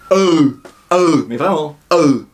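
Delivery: strained unrounded "E", really pushed; "E" appuyé, vraiment; Vietnamese ư sound. Shown as a demonstration. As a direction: insist hard on the "E". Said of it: "Insistez bien là-dessus".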